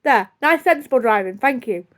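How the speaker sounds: normal talking voice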